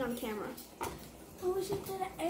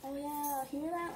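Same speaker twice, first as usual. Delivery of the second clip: ordinary talk, nothing marked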